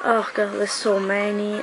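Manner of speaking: usual speaking voice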